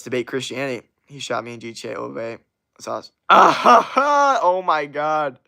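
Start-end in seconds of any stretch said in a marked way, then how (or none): 3.3-4.4 a big high laugh bursting out; loud burst of laughter. Real, not acted. Streamer reacting to a chat message with a loud high laugh.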